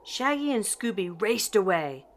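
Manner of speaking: ordinary delivery, nothing marked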